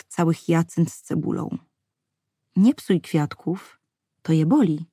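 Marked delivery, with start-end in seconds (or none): 2.6-4.8 almost pleading. A narrator's reading. Young woman gently begging a child to spare the flowers, slow plea. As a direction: coax the child to stop, slowly and gently. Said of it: "rzekła prawie prosząco".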